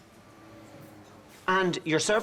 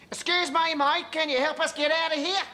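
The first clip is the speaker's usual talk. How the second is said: put-on Australian tourist accent; 12 semitones higher than usual. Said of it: "I was being an Australian tourist".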